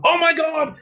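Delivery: high-pitched alarmed outburst; sudden, very high mock shriek; imitated yell. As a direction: imitate a passenger crying out in alarm. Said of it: "Like, you yelled out".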